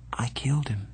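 slow, stunned whisper; whispering in shock after the stabbing. A narrator's reading. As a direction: breathe it out in disbelief. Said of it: "he said slowly".

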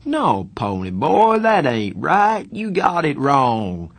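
stunned slow drawl; dumbfounded protest. A narrator's reading.

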